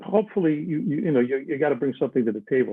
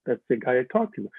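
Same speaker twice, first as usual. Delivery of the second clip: ordinary talk, nothing marked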